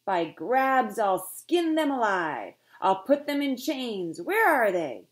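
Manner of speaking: high, loud raging shout; furious roar. A narrator's reading. Captain explodes in fury, shouting threats at stowaways. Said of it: "he exploded again".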